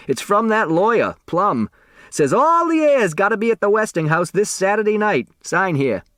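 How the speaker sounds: put-on old delivery boy voice. A narrator's reading. Otis handing Doug the letter, high old man's chatter.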